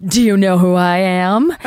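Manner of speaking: hammed-up diva question, rising at the end; mock self-importance. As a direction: play the big star demanding recognition. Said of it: "be like".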